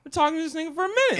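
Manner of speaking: high, fast mock voice; fast and high, hamming up the quote. Repeats quote in put-on voice.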